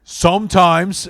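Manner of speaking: lightly voiced brother, drawn-out jab; brother's dry dig, said slow and high; pointed retort. Brother's curt retort quoted.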